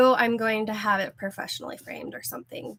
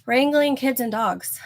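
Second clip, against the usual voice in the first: usual speaking voice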